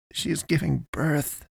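soft, high-pitched amazement; astounded whisper. A narrator's reading. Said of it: "Sam whispered".

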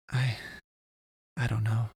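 hesitant, halting stutter; unsure, broken-up reply. A narrator's reading. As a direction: stall after the first word, then restart. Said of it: "she stuttered".

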